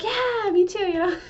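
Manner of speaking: high, bright burst of "me too"; over-eager agreement. Retold or imitated. Mimicking someone who talks over a friend, mock-eager. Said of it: "you're like".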